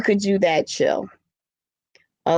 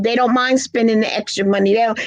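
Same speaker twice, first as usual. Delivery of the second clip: usual speaking voice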